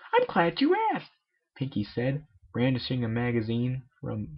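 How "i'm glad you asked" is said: high bubbly cartoon pony voice; Pinkie voice. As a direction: squeak it out as the excitable pony. Pinkie voiced in a read story.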